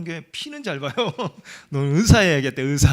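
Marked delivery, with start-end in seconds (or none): 1.7-2.9 put-on loud, laughing tease at the kid; loud teasing. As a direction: call it out loud and playfully. Teasing daughter about blood. (크게 놀리듯이)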